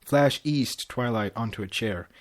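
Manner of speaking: ordinary delivery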